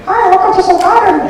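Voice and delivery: put-on high, loud Korean-accented waiter greeting. Waiter greeting diners in a Korean accent. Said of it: "in my best Korean accent".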